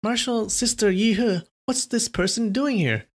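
a hint of a young girl asking; young nun. Disciple asks senior sister about stranger, lightly girlish.

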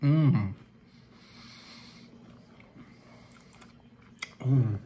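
talking while chewing food; muffled closed-mouth hum; mouth full, chewing. Real, not acted. Seen 2: Bites dipped chip at -4, cheeks full and chewing through 4s.

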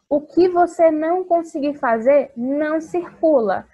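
put-on loud firm instruction to kids. Shown as a demonstration. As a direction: make sure they hear it. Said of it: "teacher stressing instruction". (instrução em voz alta e firme)

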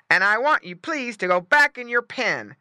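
emphatic dog telling-off; stern dog order. Acted. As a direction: order the pigs back as guard dog. Dog ordering pigs back.

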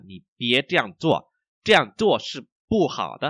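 slow, syllable-by-syllable model reading; slow, carefully enunciated Mandarin for learners. Shown as a demonstration. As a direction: read slowly so each tone is heard. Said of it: "mình đọc rất là chậm".

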